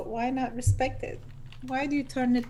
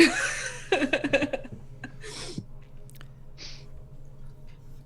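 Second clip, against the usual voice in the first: long, quiet breathy laugh; 4 semitones higher, 7 dB quieter than usual. Real, not acted. Player amused by stones on shoulders, breathy laughing. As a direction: laugh softly, mostly on breath.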